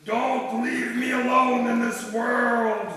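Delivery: loud, slow, pleading cry; cried out. A narrator's reading. Pirate begging the parrot not to leave him, loud slow cry. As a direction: wail it slowly with everything you have. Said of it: "cried the pirate".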